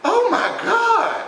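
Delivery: very high, loud, drawn-out mock scream; shocked nurse. Nurse seeing what she pulled out, hammed-up shocked cry. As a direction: wail it slowly in hammed-up, high-pitched shock. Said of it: "And she's like".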